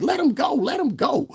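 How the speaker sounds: very high pleading squeal; hammed-up high plea; mock pleading. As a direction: beg them high and loud to let go. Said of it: "I was like".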